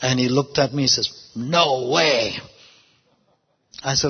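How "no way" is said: low, drawn-out "no way"; retold refusal. Man refusing the pastor's prayer, retold low refusal. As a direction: imitate his low, stubborn refusal.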